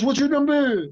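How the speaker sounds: high-pitched French impression; Jean-Claude. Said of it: "Do I have an impression of him?".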